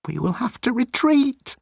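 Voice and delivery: high, timid alien voice; high-pitched nervous alien; Moan's voice. Acted. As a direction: play Moan small and pleading. Moan thinks.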